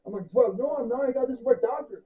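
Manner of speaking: high loud indignant denial; defensive junkie voice. Acted. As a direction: protest way too much. Acted defensive drug denial.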